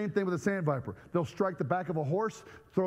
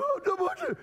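mock alarm; faster than usual. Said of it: "So I'm going".

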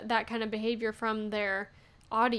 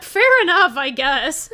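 a bright, much louder fake-casual shrug; mock resignation; 3 semitones higher, 10 dB louder, slower than usual. Replying to a rude commenter, mock resigned and loud. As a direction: brush off the insult with a breezy, higher "fine".

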